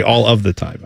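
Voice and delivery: "of" sounded as a separate word; low, measured phrase with "of" kept in; full, careful wording. Shown as a demonstration. Host showing how out of place they are, "of" fully sounded. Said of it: "I would say".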